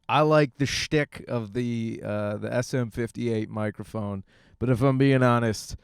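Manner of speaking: normal talking voice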